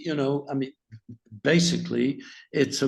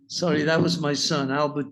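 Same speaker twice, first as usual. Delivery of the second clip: normal talking voice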